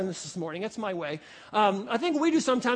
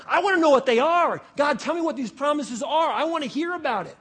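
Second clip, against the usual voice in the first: raised, insistent eagerness; eager anticipation; 3 semitones higher, 4 dB louder than usual. Acted. Believer asking God about his promises, eagerly expectant. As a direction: press keenly to hear the promise. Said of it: "we need to wait with anticipation".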